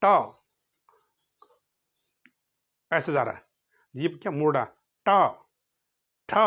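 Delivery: ट, ठ, ड, ढ, ण said with the tongue curled back; spaced-out retroflex drill; retroflex letters demo. Shown as a demonstration. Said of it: "अब देखो कैसे मोड़ेगा".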